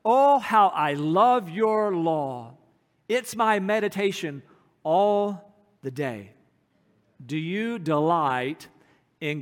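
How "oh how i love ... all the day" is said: very slow, louder, drawn-out devotion; fervent scripture quote. Acted. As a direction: linger on every word with longing. Quotes Psalm 119 with feeling.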